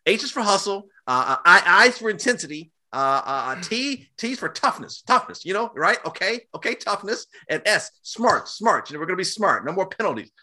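high, fast mock pep-talk voice; mocking the coach. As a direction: parody a corny coach spelling out acronyms. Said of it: "I'm like".

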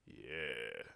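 low, soft, drawn-out yeah; reluctant, deflated agreement; unimpressed yeah. Acted. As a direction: let it sink, full of doubt. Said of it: "It was like".

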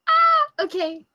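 sudden high surprised cry. Real, not acted. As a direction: caught off guard, voice jumps up. Player reacts to the GM's twist.

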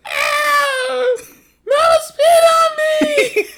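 put-on high, loud child wail of complaint; imitating a crying kid. Dad retelling his daughter's complaint: high, loud crying child voice. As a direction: squeal it like a little kid who just got spat on. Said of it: "and Tatum said".

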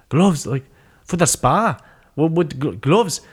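puzzled questions in a rough mock-Welsh accent; Darren Till impression. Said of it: "I can't do a Welsh accent".

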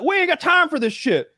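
high, exasperated mock cop; hammed-up impatient officer. Guest mimicking the cop rushing the woman, high and exasperated.

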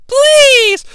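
high falsetto pleading; begging woman. Retold or imitated. Liz begging.

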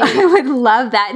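high, loud laughing reply; delighted, drawn-out laughing exclamation. Real, not acted. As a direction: laugh through the words. Laughing reply in playful exchange.

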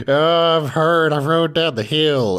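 high, loud whiny wail; mock crying. Small boy crying over his tumble, mock crying. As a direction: wail it like a hurt kid, hammed-up. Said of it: "And he's crying 'cause he's like".

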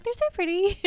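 high, loud gushing over pictures. Retold or imitated. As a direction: gush like a delighted fan. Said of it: "I was like".

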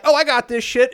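loud, high, cocky boast; cocky excited. Retold or imitated. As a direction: brag like a show-off. Jack smashes the window with rock.

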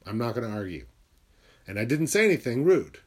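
normal talking voice

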